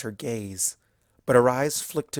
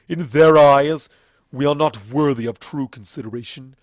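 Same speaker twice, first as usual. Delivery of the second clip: furious stallion accuser; 11 dB louder than usual. Acted. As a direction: condemn the tyrant with contempt. Everfree denounces the goddess.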